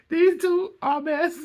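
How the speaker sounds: high, slow, laugh-squeezed. Real, not acted. Host calling the pair a mess, still laughing. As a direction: let the laugh carry the words.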